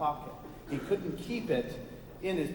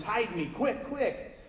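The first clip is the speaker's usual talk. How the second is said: panicked plea; 6 semitones higher, slower than usual. Acted. Cossack begs to hide.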